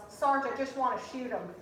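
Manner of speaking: mild quoted yell within a reading; narrated yell. A narrator's reading. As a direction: raise the voice a little for the quoted shout. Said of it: "he yells".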